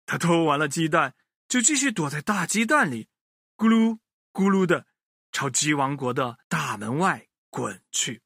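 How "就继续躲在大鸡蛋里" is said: ordinary delivery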